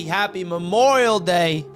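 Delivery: ordinary talk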